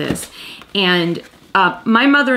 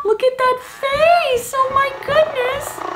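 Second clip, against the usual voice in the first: high-pitched cooing; slower than usual. Real, not acted. Cooing over the kitten.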